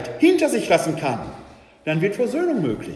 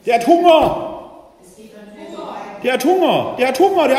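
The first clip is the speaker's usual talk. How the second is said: loud, high repeated cry; proclaimed loudly to the congregation; emphatic exclamation; 6 semitones higher, 9 dB louder than usual. Acted. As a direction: drive the point home with a shout. Preacher hammers home the point.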